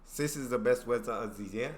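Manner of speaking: "weather" with a z for th; learner's try at German "Sis", s for th; attempted German accent. Shown as a demonstration. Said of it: "am I getting close".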